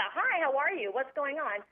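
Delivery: ordinary delivery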